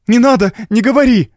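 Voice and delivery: high, loud, urgent interruption; distressed cry. A narrator's reading. Evelyn stops her husband, crying out high and loud. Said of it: "воскликнула она".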